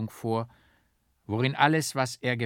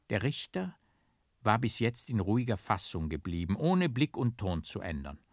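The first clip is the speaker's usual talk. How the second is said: usual speaking voice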